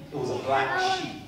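ordinary talk

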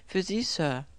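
viel höher, kindliche Stimme; kleiner Junge. A narrator's reading. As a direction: als kleiner Junge sprechen. Kleiner Junge mit Paket. (Botenjunge, hoch und kindlich)

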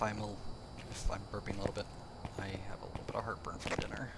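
mumbled under breath; low mumble about heartburn; quiet mumble. Real, not acted.

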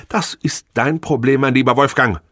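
lightly voiced cold dismissal; cool. Wife cutting husband off, coolly dismissive. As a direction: brush him off coldly. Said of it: "unterbrach sie ihn kühl".

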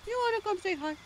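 very high cooing pet voice; pet talk. Real, not acted. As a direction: coo it sweetly to a pet. Same words cooed to the pet.

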